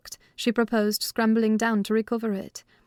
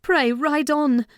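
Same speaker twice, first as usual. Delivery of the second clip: calling out; 5 semitones higher, 4 dB louder, slower than usual. A narrator's reading. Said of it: "I called from the inside".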